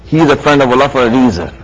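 normal talking voice

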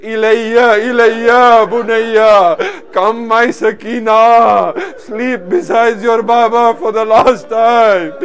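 loud, slow, drawn-out lamenting call. Retold or imitated. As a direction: summon a child mournfully, stretching each phrase. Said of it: "a voice calling out from here saying".

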